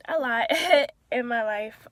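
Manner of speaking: loud, high laugh through tears; laughing to hold back crying; tearful laugh. Real, not acted. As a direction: break into a real laugh while tearing up. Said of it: "I don't know why I'm tearing up".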